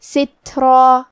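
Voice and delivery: سترہ with the breathy ت; tajweed demo. Shown as a demonstration. Teacher modelling سترہ for students. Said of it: "ہمزے سے پڑھیں گے".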